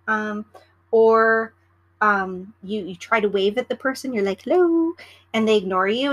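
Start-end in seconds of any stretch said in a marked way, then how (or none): 4.4-4.9 higher, louder sing-song "Hello"; bright high called "Hello" to get noticed; calling hello. Retold or imitated. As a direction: call "Hello" up high to catch attention. Waving to ignored passenger.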